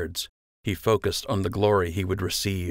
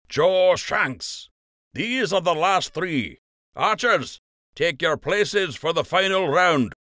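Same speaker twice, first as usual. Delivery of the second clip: higher, slow, ringing announcement; Master Fletcher announcing; 10 semitones higher, 4 dB louder, slower than usual. A narrator's reading. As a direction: summon the archers to their places. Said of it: "Master Fletcher called".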